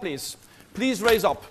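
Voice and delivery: usual speaking voice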